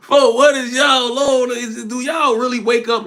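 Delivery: incredulous call to chat; exasperated. Real, not acted. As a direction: ask it like you can't believe them.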